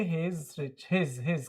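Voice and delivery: "He is" merged into one syllable, his; contracted HE'S. Shown as a demonstration. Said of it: "आप इस तरीके से pronounce करेंगे". ("He is" को "हीज़" जैसा मिलाकर)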